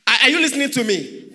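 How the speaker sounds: loud call-out. Real, not acted. Preacher calling out to his congregation, loud and fast. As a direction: demand their attention, loudly and quickly.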